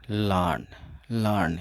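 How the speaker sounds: लर्न said "lan", the r dropped; लर्न with no r, short and falling; silent r demo. Shown as a demonstration. Teacher showing students लर्न with the r dropped. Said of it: "आर इसमें drop हो जाएगा".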